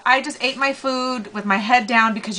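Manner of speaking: ordinary talk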